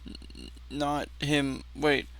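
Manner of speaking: normal talking voice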